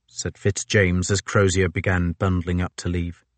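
usual speaking voice

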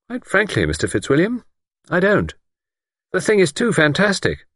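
lightly voiced doctor. A narrator's reading. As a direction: play the calm, sceptical doctor lightly. Narrator voices Doctor Thomas.

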